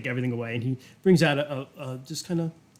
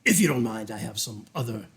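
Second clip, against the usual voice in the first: lower, courteous dismissal; a hint of lordly politeness, measured; lightly voiced sinister duke; 5 semitones lower than usual. Duke excusing himself to other business, lightly formal. Game master plays the Duke.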